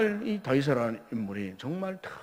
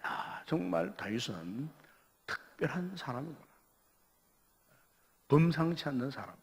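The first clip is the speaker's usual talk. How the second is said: musing admiration; 4 semitones lower, 8 dB quieter than usual. Retold or imitated. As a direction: marvel softly to yourself. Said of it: "그런 생각을 했어요".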